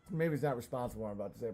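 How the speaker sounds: ordinary delivery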